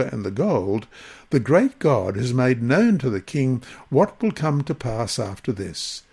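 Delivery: ordinary talk, nothing marked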